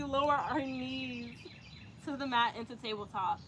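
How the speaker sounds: soft, calm breathing cues; guided yoga. Acted. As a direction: ease the class through each pose. Leads yoga breathing and poses.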